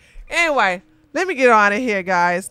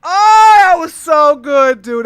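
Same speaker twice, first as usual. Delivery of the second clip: loud, high delighted cry; real gleeful outburst; excited exclamation; 5 semitones higher, 10 dB louder than usual. Real, not acted. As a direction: burst out in delight. Reacting to funny clip.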